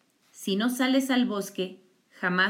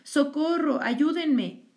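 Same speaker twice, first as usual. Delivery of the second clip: wolf crying for help; 7 semitones higher, much slower than usual. A narrator's reading. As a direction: howl out for help like a trapped animal. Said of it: "gritaba el lobo".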